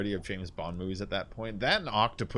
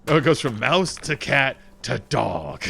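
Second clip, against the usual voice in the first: lightly voiced producer making a pitch; a hint of a boardroom presenter; 3 semitones higher, 6 dB louder than usual. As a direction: act out an executive's pitch, punching key words. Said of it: "when the producers are like".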